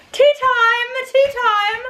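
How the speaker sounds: high, loud, hammed-up British accent; mock British accent. Mother mocking how the English talk for her kids, hammed-up British accent. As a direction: play the British accent up for laughs. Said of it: "They talk like this".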